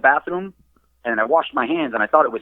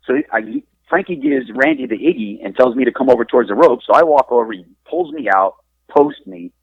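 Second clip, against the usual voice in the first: ordinary delivery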